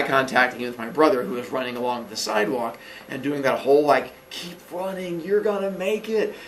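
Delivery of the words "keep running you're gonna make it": hushed, slightly raised cheer; softly mimed long-distance encouragement; urging from afar. Retold or imitated. Boy pulled backwards urging his brother on, hushed cheer.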